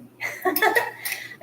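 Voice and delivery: airy unvoiced giggle; breathy laugh. Real, not acted. Laughs after mock whisper.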